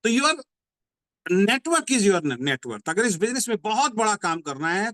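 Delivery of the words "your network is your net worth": each word of your network is your net worth drawn out very slowly; slow, weighty motto delivery; slow emphatic slogan. Shown as a demonstration. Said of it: "slogan repeated as motto".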